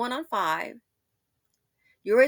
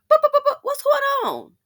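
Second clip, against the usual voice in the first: high squeaky mimicry; 8 dB louder than usual. Mimicking the phone sounding off.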